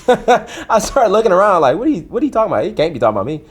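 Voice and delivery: high, loud, laughing bewilderment; hammed-up incredulous reenactment; mock disbelief. Laughingly acts out his startled disbelief.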